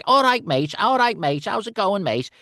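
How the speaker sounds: high, loud cheeky British "all right, mate"; cheery London lads greeting you; British scout guys. Retold or imitated. Actor voicing the UK scouts in a high, chirpy British accent. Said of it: "two scout guys going".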